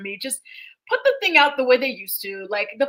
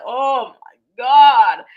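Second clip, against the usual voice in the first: loud, very drawn-out dismay; appalled exclamation; 10 dB louder, much slower than usual. Real, not acted. Reacting to a strong Minnesota accent, appalled drawl. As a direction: groan it out loudly and slowly.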